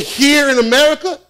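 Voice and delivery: can't believe his ears; incredulous. Retold or imitated. Incredulous reply in retold dialogue.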